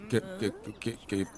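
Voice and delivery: nervous stutter on the first sound; halting, stammered promise; stammering. Acted. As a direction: get stuck repeating the opening syllable, then push through. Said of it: "ये हकला है।".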